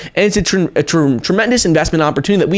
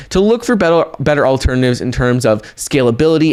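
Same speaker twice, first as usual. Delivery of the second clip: usual speaking voice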